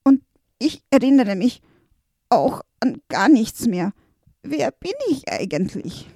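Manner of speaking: high, anxious creature voice; desperate little helper. Acted. Little helper tells the master of the curse, desperate. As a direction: pour out the disaster in a high panicky rush. Valentin still speaking.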